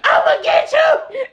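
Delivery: hammed-up screech of revenge; mock shout. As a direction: yell it out like you're chasing the car. Acting out threat at cheating man.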